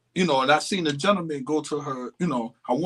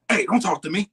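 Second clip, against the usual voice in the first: imitating a sharp snap; mock angry snap, higher; snapping; 4 semitones higher, faster than usual. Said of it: "She just, like, snapped out".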